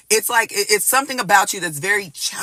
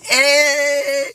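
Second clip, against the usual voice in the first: higher, louder eager hey; excited greeting; 7 semitones higher, 3 dB louder than usual. Retold or imitated. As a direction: call it out thrilled. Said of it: "it's like you like".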